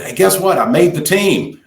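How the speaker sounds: lightly voiced excited kid. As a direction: play the kid bursting with news. Kid announcing news, voiced.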